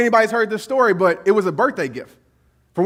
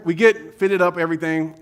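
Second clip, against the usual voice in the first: ordinary talk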